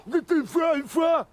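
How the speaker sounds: put-on shouted, high Belgian accent; Belgian accent on demand. After being told to redo the Belgian accent, a shouted Belgian accent. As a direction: belt out a Belgian "une fois" on request. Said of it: "Refais l'accent belge".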